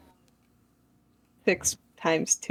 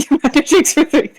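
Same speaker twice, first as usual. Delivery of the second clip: laughing; 8 semitones higher, 6 dB louder than usual. Acted. Repeating order, laughing.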